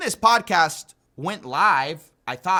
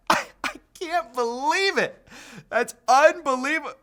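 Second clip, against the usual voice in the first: laughing disbelief; 6 semitones higher than usual. Real, not acted.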